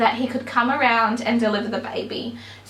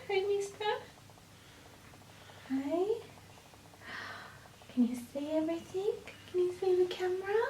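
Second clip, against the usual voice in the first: mother hushing her sleepy newborn son; 4 semitones higher, 14 dB quieter than usual. Real, not acted. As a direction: coo gently to a sleepy newborn. Mother talking to newborn baby.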